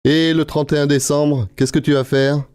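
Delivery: ordinary talk, nothing marked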